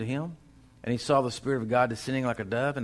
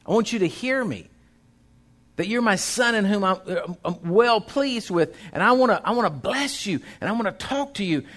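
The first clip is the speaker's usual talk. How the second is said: rising, louder preaching build; full-force preaching; 7 semitones higher, 5 dB louder than usual. Acted. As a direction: drive it home to the congregation.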